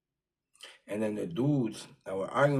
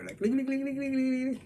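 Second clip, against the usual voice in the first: very high, rapid repeated clicks for kicking feet; dangling feet sound effect. Retold or imitated. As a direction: tick out the feet flailing in the air.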